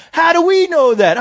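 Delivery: high-pitched, sceptical cop retort; challenging cop. Retold or imitated. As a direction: challenge them, pitch jumping up. Retold cop challenges the speaker.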